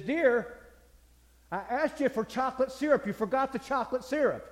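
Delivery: put-on high, loud indignant old husband; husband grumbling that his wife forgot the syrup; complaining husband. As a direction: scold her for the missing syrup.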